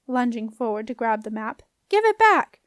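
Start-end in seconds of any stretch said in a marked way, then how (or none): 1.9-2.6 high, loud demand; yelling. A narrator's reading. Girl grabbing for her torn map, yelling. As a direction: shout it, fighting to grab it. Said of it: "yelled Jenna".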